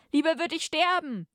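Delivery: high-pitched, hammed-up defiance; defiant cry. Captive defying the torturer, hammed-up high cry.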